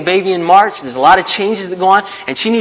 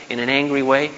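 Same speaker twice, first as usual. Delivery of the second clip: ordinary talk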